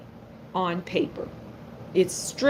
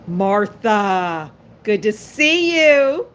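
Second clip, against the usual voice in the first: delighted greeting; 6 dB louder, much slower than usual. Real, not acted. Reader greeting Martha mid-reading, delighted and loud. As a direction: call out a big, stretched welcome.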